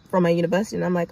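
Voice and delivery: ordinary talk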